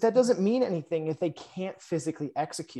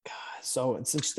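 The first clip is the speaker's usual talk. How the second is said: whispered; 7 dB quieter than usual. Real, not acted. Awed praise of a colleague.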